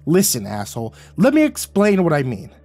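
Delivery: loud, high angry tirade. A narrator's reading. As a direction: shout him down and keep hammering. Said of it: "I raised my voice and cut him off".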